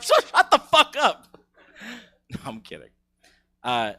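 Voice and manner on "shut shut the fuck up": mock shout. Comic retorts in acted voice.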